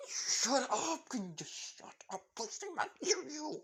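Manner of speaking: high, squeaky flustered protest; flustered teen yelping at his teasing friends; embarrassed. Acted. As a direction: beg them to stop, mortified and high. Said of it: "Fred being embarrassed".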